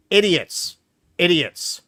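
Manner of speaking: exasperated name-calling. Real, not acted.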